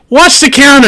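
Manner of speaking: high, loud bark of an order; yelled order. Acted. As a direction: call out for the counter to be watched. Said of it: "He goes".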